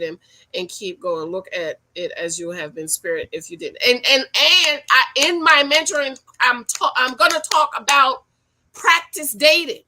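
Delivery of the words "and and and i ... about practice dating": emphatic announcement. Real, not acted. Announcing her mentoring topic.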